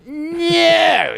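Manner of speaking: very high, drawn-out no; outraged refusal. Retold or imitated. Tenant rejecting Tuesday's visit too, high outraged refusal. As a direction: wail the refusal in exaggerated outrage.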